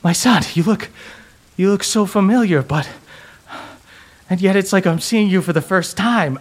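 a mother seeing her son anew; mother's voice. Acted.

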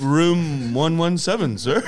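mock desk clerk, spelling it out; receptionist. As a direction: answer like an over-helpful receptionist. Acted receptionist answering lost guest.

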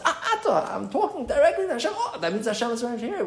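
high, delighted aha with a laugh; an amused, high-pitched lightbulb moment; sudden realization. Pretend. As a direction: play the person suddenly getting it, amused. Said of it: "that helps a person realize".

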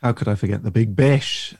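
ordinary delivery

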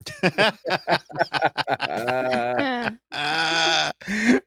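big helpless laugh at the joke; real laughter. Real, not acted. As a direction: crack up for real, high and breaking.

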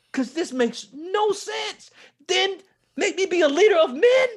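fervent testimony shout; preaching shout. Real, not acted.

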